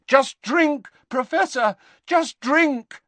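high, pressing urging; urgent pleading. Acted. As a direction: urge desperately, pitch well up. Harry urging the screaming Dumbledore.

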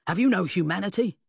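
loud, outraged plea; railing. A narrator's reading. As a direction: rail at him in disgust. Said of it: "Pound railed".

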